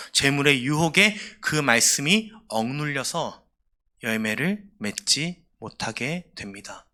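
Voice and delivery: ordinary delivery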